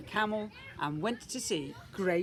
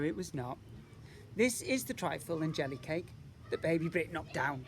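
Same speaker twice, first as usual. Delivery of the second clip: ordinary delivery, nothing marked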